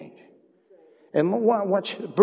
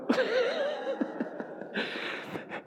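laugh; 8 dB quieter than usual. Real, not acted. Laughs after God's reply.

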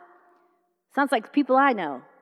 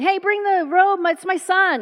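raised, eager call; overjoyed father; 7 semitones higher than usual. Acted. Father welcoming his son home, overjoyed.

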